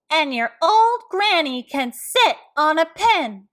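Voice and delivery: high, loud taunting pig voice; pig yelling insult. Acted. Third pig taunting the wolf about his granny, loud and high. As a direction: hurl the insult through the door. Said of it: "the third little pig yelled".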